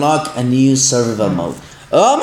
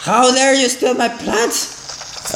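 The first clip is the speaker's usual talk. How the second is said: mock outrage; 8 semitones higher, much slower than usual. Real, not acted. Zombies stealing his plants.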